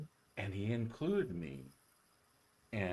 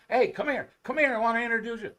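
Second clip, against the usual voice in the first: high, loud call across the field; 13 semitones higher, 10 dB louder, faster than usual. Retold or imitated. Eddie calling a young player over to meet Ted, loud eager call. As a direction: shout him over, eager.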